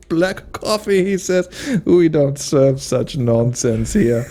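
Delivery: high, slow haughty sneer; mustache twirling villain. Retold or imitated. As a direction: look down on the customer. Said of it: "as he twirls his mustache".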